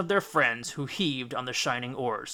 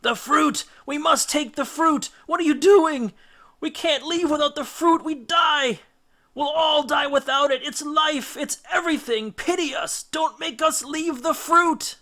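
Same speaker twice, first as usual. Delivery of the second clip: much higher, louder, frantic pleading; desperate shrieking; 13 semitones higher, 5 dB louder than usual. A narrator's reading. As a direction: scream for the fruit as if dying without it. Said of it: "pleaded and shrieked".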